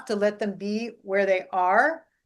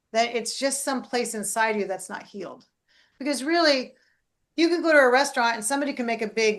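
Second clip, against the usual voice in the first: ordinary delivery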